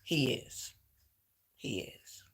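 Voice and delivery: whispered through tears; choked up. Real, not acted. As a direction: voice breaks into a whisper. Crying while talking about dad.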